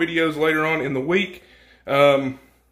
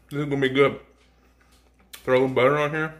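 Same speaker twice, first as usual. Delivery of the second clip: talking with a mouthful; chewing while talking; mouth full; faster than usual. Real, not acted. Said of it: "I'm talking with my mouth full".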